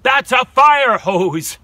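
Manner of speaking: exasperated fire chief. Acted. Fire chief scolding the dragon, high and loud. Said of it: "the fire chief cried".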